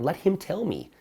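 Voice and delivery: ordinary talk, nothing marked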